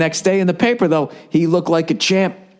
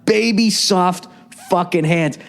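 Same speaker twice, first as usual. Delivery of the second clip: slow, savoured; 4 semitones higher, slower than usual. Shown as a demonstration. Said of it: "Now that I have a daughter".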